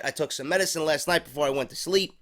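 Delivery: usual speaking voice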